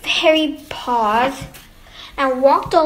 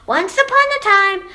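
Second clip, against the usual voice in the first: high, louder storytelling start; eager story opening; 8 semitones higher, 5 dB louder, faster than usual. A narrator's reading. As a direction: launch eagerly into a tale. Child starts telling story.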